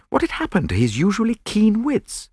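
normal talking voice

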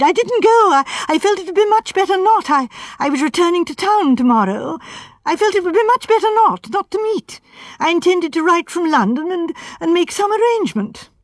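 rattled, words rushing; high and excited. A narrator's reading. Suspect explains himself to inspector, high and excited. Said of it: "Sandford's voice rose high and excited".

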